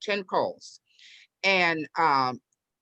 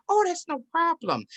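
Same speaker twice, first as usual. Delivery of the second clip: high, airy imitation of friendly sellers; lightly mimicked 'no worries' voice; reassuring couple; 12 semitones higher than usual. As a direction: brush it off sweetly, pitched way up. Smiling couple reassures her.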